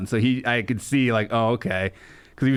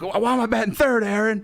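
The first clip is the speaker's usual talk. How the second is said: imitating angry T I; 9 semitones higher than usual. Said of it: "He'd be like".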